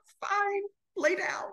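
high, small tearful squeak; retold crying voice; mock weepy. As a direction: imitate squeaking it out through tears. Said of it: "I'm just crying, I'm weeping".

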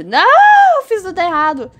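loud, very high wail, then easing; real high-pitched wail of frustration; dismayed cry. Real, not acted. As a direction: cry out in dismay at your own mistake. Botches a move in the race.

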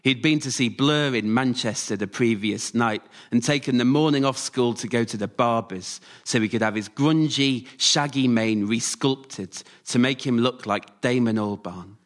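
plain reading voice

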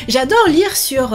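ordinary delivery

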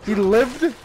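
loud, high disbelief; shocked he's still alive; surprised. Real, not acted. Reacting to enemy surviving.

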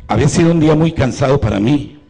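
loud pulpit storytelling; preaching at full force. Acted. Priest telling the congregation his testimony, loud preaching voice. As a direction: project the testimony to the back pews.

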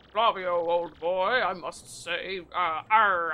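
put-on half-hearted old sailor accent; fading pirate accent. Bobbery addressing Flavio in a fading pirate accent. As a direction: try for an old sea dog, losing it. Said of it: "I'm losing any accent".

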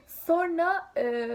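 usual speaking voice

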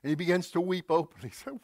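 normal talking voice

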